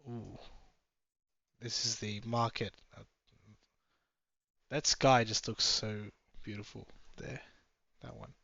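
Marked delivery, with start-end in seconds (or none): none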